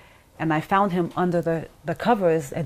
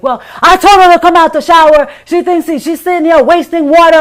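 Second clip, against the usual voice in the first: much louder, higher, fast justifying rant; mock angry husband; 12 semitones higher, 17 dB louder than usual. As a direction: bark out the excuse like the angry father. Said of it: "He's like".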